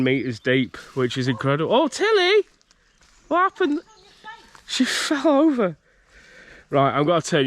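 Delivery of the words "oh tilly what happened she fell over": much higher alarmed call to the dog; startled call. Real, not acted. Calling out to Tilly after she falls over, startled and high. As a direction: cry out in sudden alarm, high.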